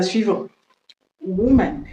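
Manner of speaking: ordinary delivery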